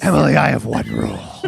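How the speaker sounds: growled masked-vigilante impression; Batman. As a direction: lay down the law in a hero's growl. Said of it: "to do that voice".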